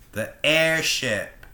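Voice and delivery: The airship drawn out slowly, dawning on him; slow realization. Acted. Professor realizes Tilda is ship.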